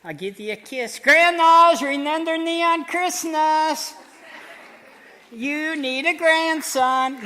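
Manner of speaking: ventriloquist's puppet begging loudly; squealing puppet plea. Acted. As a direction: puppet wheedling the grandmas for Christmas. Puppet begs grandmas for gifts.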